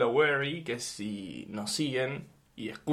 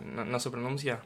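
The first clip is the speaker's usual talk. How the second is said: ordinary talk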